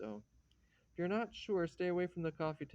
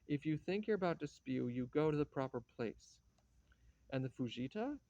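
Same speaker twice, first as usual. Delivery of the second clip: usual speaking voice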